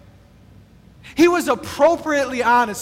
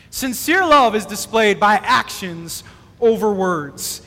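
slow, weighty statement; 4 semitones lower, slower than usual. Shown as a demonstration. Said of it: "repeated key phrase".

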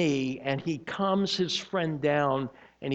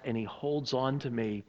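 usual speaking voice

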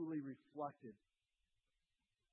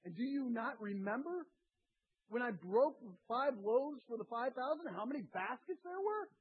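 higher and louder, hammering each question; preaching at full force; 7 semitones higher, 4 dB louder than usual. Acted. Preacher voicing Jesus pressing the disciples, full force. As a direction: drive every question home.

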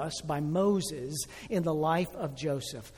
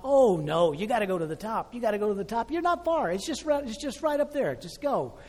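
much higher, bright encouraging hiker voice; cheerful urging; 5 semitones higher, 4 dB louder than usual. Retold or imitated. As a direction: cheer a tired climber on. Hikers urging him upward.

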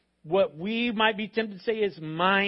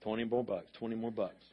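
mock teen begging; 8 semitones lower, 5 dB quieter than usual. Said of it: "An A T M parent".

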